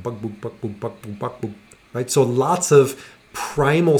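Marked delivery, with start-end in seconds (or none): 0.0-1.5 bakbuk said low and soft like glugging; gulping sound. Shown as a demonstration. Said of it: "what does it sound like?".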